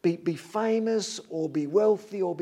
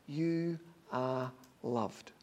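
tender; 5 semitones lower, 6 dB quieter, much slower than usual. Acted. God's words spoken to listener.

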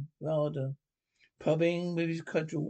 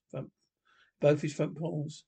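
ordinary delivery

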